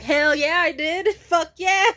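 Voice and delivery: loud, high triumphant yell with a laugh; cocky shout. Retold or imitated. Boyfriend crowing back at her praise, loud and laughing. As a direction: crow like you just won. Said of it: "He'd be like".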